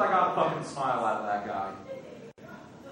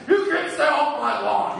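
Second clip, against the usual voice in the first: put-on higher, louder cranky old-man yell; grumpy old man; 9 semitones higher, 6 dB louder than usual. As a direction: bark it like a grouchy old neighbour. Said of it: "that's why old guys are so mad".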